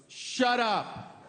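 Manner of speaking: imitated angry mother, pitched high; mother's snapped order. Baby's mother answers in the theater, high angry retort. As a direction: silence someone like a fed-up mother. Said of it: "the mother of the baby goes".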